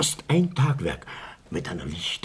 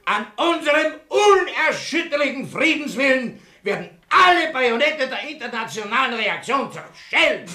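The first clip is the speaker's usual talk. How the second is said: pompous speech; 10 semitones higher than usual. Acted.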